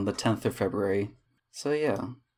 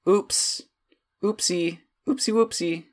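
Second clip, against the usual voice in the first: high-pitched, mock-sheepish baby talk; cutesy and much higher; cutesy oops; 10 semitones higher, slower than usual. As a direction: play up a silly little apology. Playful cutesy apology for long absence.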